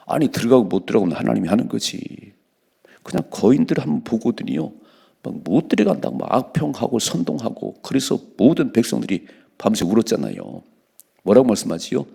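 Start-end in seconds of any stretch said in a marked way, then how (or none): none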